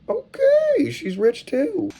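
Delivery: very high, drawn-out falsetto; slow, squeaky-high mock-impressed aside; teasing aside. Real, not acted. Teasing aside on her buying more.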